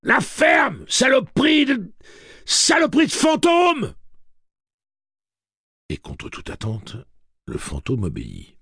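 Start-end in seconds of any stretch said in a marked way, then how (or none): 0.0-3.9 furious high screaming; furious yell. Acted. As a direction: scream at the ghost. Eddie yells at ghost.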